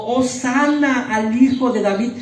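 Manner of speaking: mock cry of the crowd hailing; crowd acclaiming. Preacher voicing the crowd's acclaim, a mild mock cry. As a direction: hail him like the crowd. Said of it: "Esa multitud que lo aclamó y que gritó".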